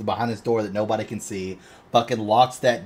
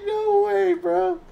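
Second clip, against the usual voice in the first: high, squeaky bro voice; hammed-up stoked dude; mock excited dude; much slower than usual. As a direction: pitch it way up like a giddy bro. Mocking an excited redneck.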